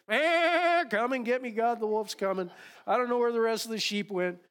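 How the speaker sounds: high, loud, fast bleating plea for rescue; a frantic sheep calling out for God; panicky lost sheep. Acted. Lost sheep to God, panicky high bleating call. Sheep voiced crying for help.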